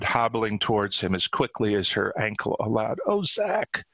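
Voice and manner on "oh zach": high-pitched, tearful relief; acted distraught cry; overcome, voice pitched up high; sobbing. A narrator's reading. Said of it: "Cordelia sobbed".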